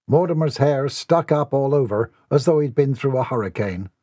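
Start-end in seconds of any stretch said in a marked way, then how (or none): none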